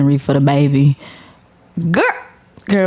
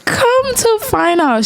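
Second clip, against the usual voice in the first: laughing high; slower than usual. Real, not acted. Restarting reveal after laughing.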